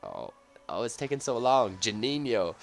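ordinary talk

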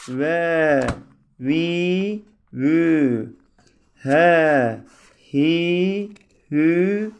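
loud, spaced syllable drill; reciting syllables. Shown as a demonstration. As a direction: call out each sound for the class to copy. Teacher drills letter sounds.

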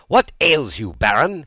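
high, loud startled king; sharp rise in pitch and volume; exclaimed. A narrator's reading. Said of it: "he exclaimed".

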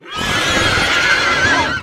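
slow, loud, stretched out; drawn-out loud announcement. Acted. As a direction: announce it slowly and loudly. Drawn-out loud intro line.

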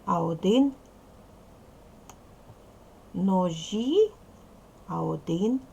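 ordinary talk, nothing marked

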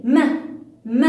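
م with a short "a", as "ma"; letter sound demo. Shown as a demonstration. Teacher answering how the letter sounds, short isolated syllable. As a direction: punch out م as one short open syllable. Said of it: "كيف صوتها؟".

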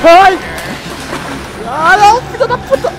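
sudden shrieking high panic; terrified scream. Real, not acted. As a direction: scream in real fright. Jump scare in horror game.

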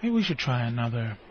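low, soft, halting delivery; hushed, measured thespian voice; self-serious actor. Retold or imitated. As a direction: imitate an earnest actor giving a careful note. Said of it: "'cause he's an actor, he'll go".

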